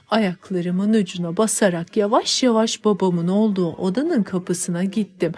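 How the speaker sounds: ordinary delivery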